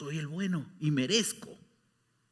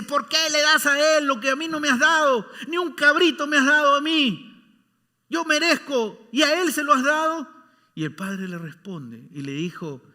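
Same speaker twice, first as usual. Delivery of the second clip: loud, higher protest; indignant son; 10 semitones higher, 8 dB louder than usual. Acted. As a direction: complain hard that it's unfair. (hijo indignado, reclamo fuerte y agudo)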